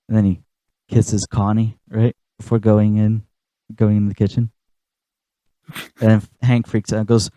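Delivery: ordinary talk, nothing marked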